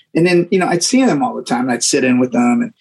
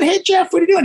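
calling out again. Retold or imitated. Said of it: "I just said".

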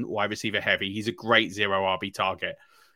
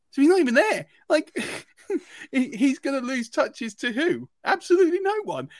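rising excitement over no competition; excited disbelief; 4 dB louder than usual. Real, not acted. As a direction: pitch climbing higher, incredulous. Incredulous rant about empty roster.

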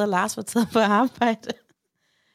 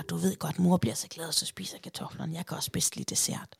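conspiratorial whisper; 3 semitones lower, 6 dB quieter than usual. Retold or imitated.

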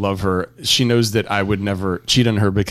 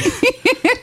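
shrill burst of laughter; helpless high-pitched laugh; high laughing squeal. Real, not acted. Laughing at creepy voice.

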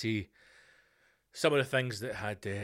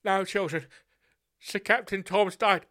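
posh mother; 6 semitones higher, 4 dB louder than usual. Pretend. Mother breaking the news.

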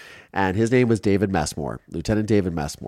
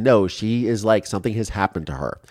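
ordinary delivery

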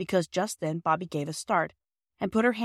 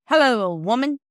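louder, hearty working man; cheerful Perks; 5 semitones higher, 8 dB louder than usual. A narrator's reading. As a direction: greet the wife heartily coming home. Perks greets his wife.

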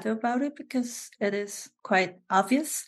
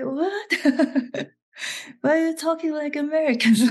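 puzzled Korean onlookers; 8 semitones higher, 3 dB louder, faster than usual. Retold or imitated. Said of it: "people just watching me".

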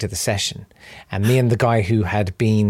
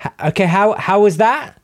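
lightly voiced query, pitched up; a higher, tentative studio check; booth person checking in; 8 semitones higher than usual. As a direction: ask the speakerphone for a verdict, higher. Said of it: "sort of said to the f-, speakerphone".

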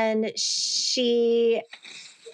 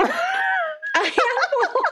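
high, slow disbelieving echo; shocked; 14 semitones higher, much slower than usual. Real, not acted. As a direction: repeat it in laughing disbelief.

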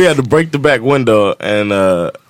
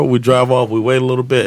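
ordinary delivery, nothing marked

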